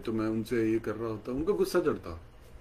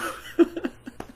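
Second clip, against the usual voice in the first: high breathy laugh. Retold or imitated. Guru laughing.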